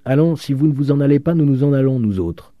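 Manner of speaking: high, loud, sustained insistence; ultimatum. A narrator's reading. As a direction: issue an exasperated ultimatum. Student threatens to leave.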